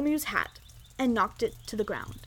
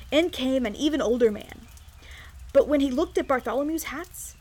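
usual speaking voice

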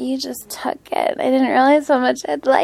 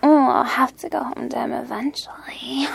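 whispering. Acted. Whispered tease.

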